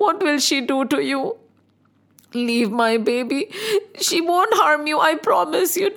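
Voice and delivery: acted anguished plea; desperate begging. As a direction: beg for the child's life. Mother begs for her baby's life.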